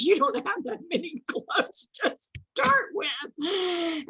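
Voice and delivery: high, slow, crying-laughing mom voice; mock-exasperated mother, drawn out; mom's retort. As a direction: mimic mom laughing through the line. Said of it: "And she goes".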